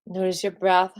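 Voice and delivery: slow, calm breathing cues; guided meditation cue. Acted. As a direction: guide their attention gently.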